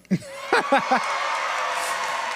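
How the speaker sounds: breathy high giggle; high unvoiced laugh. Real, not acted. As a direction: giggle in disbelief. Laughing at Spanish line.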